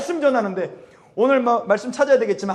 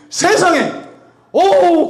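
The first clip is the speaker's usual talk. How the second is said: high, loud amazed cry; astonished exclaim; 7 semitones higher, 4 dB louder, much slower than usual. Retold or imitated. Retelling amazement. (높고 크게 깜짝 놀라 외치며)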